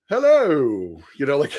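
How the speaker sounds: high, drawn-out British hello; British accent. Retold or imitated. Storyteller greets roommate's coworker at the door in a put-on British accent. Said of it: "have a British accent all weekend".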